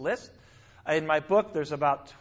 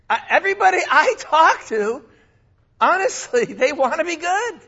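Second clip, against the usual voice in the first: excited insistence; 10 semitones higher, 7 dB louder than usual. Real, not acted. Preacher insists, laughing.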